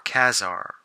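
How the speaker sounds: Kazar said as KAZ-ar; short "a" as in cat in Kazar; second pronunciation variant. Shown as a demonstration. Collector offering another way to say the name, Kazar as KAZ-ar. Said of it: "whatever way you pronounce it".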